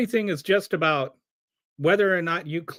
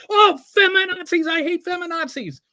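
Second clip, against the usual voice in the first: much higher, hammed-up ranting caricature; mock talk radio host; 11 semitones higher, 3 dB louder than usual. Mocking Rush Limbaugh's rants to his audience, high caricature voice. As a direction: sneer it out like a cartoon shock jock. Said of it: "they're just like".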